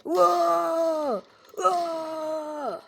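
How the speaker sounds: high, loud cry of alarm; startled shout. Acted. Michael shouting in shock as he fires at Chica. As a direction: yell as the gun goes off.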